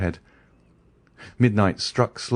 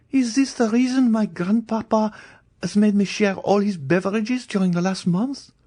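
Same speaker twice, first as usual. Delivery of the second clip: put-on a young girl's voice, much higher, pleading in disbelief; a terrified girl refusing to believe; frightened young Valentine; 13 semitones higher than usual. A narrator's reading. Valentine asks about grandpapa.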